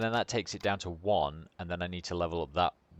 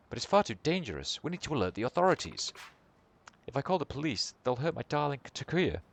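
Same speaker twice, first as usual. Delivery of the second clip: higher-voiced anxious mother, lilting; pleading mother; 8 semitones higher, faster than usual. Mother begging Kiryu for help, higher pleading voice. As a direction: plead as a worried mother, pitch lifted.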